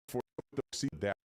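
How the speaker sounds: ordinary talk, nothing marked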